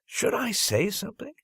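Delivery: ordinary delivery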